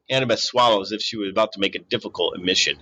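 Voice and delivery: usual speaking voice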